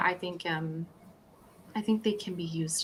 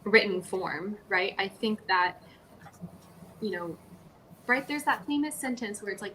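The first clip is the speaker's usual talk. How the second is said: usual speaking voice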